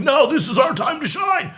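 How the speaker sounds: mock the eager viewers with a gleeful shout; mock eager supporter. Host imitating his own excited viewers, mock gleeful shout. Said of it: "a lot of you guys are probably like".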